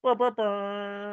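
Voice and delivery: put-on baby babbling.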